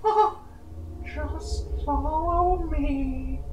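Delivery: eerie stranger. Acted. Stranger offering to find a friend, eerie beckoning. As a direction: lure them along slowly.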